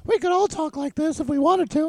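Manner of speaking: high squeaky cartoon voice; silly high mock tough-guy squeak; Mickey Mouse voice. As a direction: push the pitch way up like a cartoon mouse. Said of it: "in a ridiculous voice".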